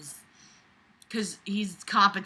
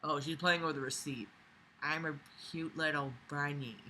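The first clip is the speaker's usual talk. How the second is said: cooing to pet; 6 semitones lower, 14 dB quieter than usual. Real, not acted.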